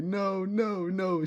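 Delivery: high mock voice of an angry girlfriend; mad girlfriend. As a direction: squeak out her annoyed no's. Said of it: "She's mad, man".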